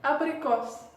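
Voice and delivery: "abricos" with a clearly sounded final s. Shown as a demonstration. Teacher repeating apricot in Russian for learners, final S sounded. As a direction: repeat ABRICOS, finishing on a crisp s. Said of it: "On répète".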